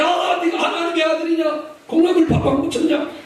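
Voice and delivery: high-pitched, hammed-up taunt; mocking jeer. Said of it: "얼마나 조롱하는 거야".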